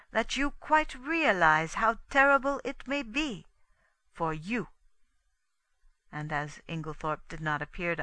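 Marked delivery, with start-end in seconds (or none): 0.1-4.7 higher, mildly pointed warning. A narrator's reading. Poirot warning Inglethorpe, mildly pointed. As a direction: press the point at a raised pitch. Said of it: "said Poirot pointedly".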